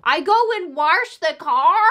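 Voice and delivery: mock Midwestern accent with an R in wash. Host teasing her mum, mock Midwestern accent. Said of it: "your terrible Midwestern accent".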